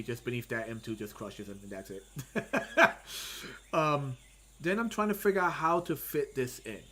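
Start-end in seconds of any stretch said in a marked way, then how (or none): 2.1-3.7 high breathy burst of laughter; a real unvoiced giggle, higher. Real, not acted. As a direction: laugh out at the silly ending. Laughing at his own comic.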